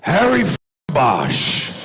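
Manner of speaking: a sudden high, loud gravelly Pacino bark; spring it on him like a gleeful Pacino; high, loud Pacino-style greeting; Al Pacino impression. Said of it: "Al turns around and he goes".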